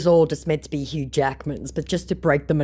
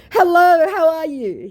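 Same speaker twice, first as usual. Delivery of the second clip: very high, loud cooing greeting; mock sugary hello; 8 dB louder than usual. The returning scammer greeting her, very high and cooing. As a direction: coo it like a phony star. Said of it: "he says".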